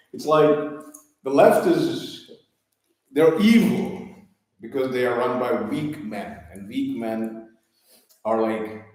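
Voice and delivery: usual speaking voice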